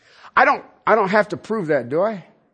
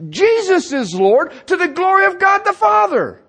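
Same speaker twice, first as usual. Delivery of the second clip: high, loud, driving sermon voice; fervent preaching; 6 semitones higher, 4 dB louder than usual. Acted. Preacher to the congregation, raising the pitch and volume. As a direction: preach it out to the whole church.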